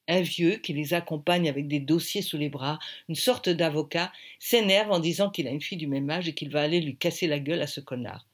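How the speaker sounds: ordinary delivery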